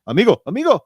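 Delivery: high, loud imitation of a Spanish commentator; mimicking Spanish commentators. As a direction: toss off the commentators' amigo like them. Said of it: "and they go".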